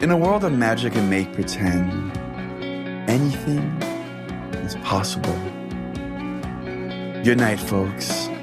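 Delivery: plain reading voice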